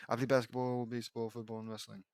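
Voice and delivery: low, fading mumbled mock voice; [name]. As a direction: reread it low, trailing off very softly. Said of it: "It's [name] who's talking".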